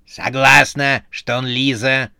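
usual speaking voice

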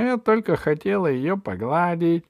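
high-pitched fussy excuse; flustered witch. A narrator's reading. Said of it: "засуетилась ведьма". (суетливое оправдание ведьмы высоким голосом)

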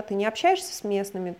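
ordinary talk, nothing marked

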